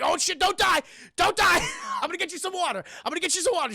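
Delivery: high, loud frantic pleading; panicked shout. Retold or imitated. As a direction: rush to keep him alive. Tuco panicking over dying man.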